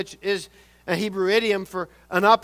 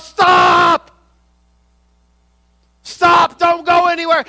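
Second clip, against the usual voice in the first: frantic shout, much higher and louder; father's yell; 10 semitones higher, 11 dB louder, slower than usual. Retold or imitated. Father shouting to his child near traffic, frantic yell. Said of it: "he yells to his child".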